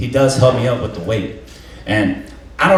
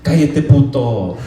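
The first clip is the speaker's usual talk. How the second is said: mock cussing out; 4 semitones higher, slower than usual. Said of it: "He cusses him out".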